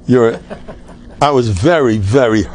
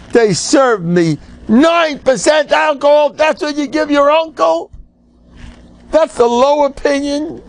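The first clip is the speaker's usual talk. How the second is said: mock outrage; 10 semitones higher than usual. Rabbi joking to congregation about weak simcha drinks, mock outrage. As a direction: play the insulted uncle for laughs.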